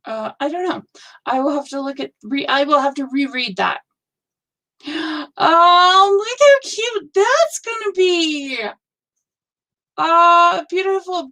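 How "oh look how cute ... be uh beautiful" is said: high, bright cry of delight; real thrilled gush over a pattern; gushing over a friend's new pattern. Real, not acted.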